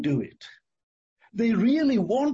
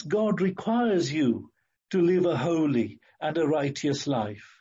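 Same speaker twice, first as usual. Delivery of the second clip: ordinary talk, nothing marked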